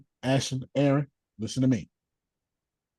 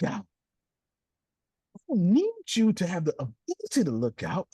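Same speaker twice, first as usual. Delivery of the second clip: preaching; 14 semitones higher than usual. Acted. Preaching sermon at full force.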